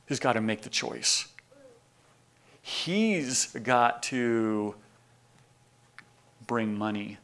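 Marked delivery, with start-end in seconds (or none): 2.6-7.2 slow deliberate. Acted. Slow dramatic landing.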